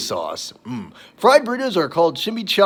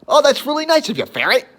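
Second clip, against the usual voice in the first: lizard voice; 9 semitones higher, 3 dB louder than usual. Retold or imitated. Frank answers ferret in ad.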